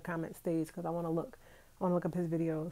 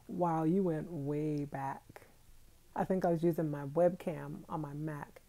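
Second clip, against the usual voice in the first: ordinary delivery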